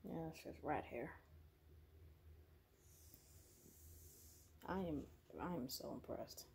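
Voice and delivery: hushed whisper, keeping the house asleep. Real, not acted. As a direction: whisper so you don't wake anyone. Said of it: "I know I'm whispering".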